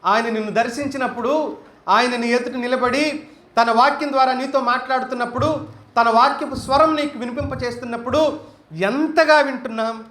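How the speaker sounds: usual speaking voice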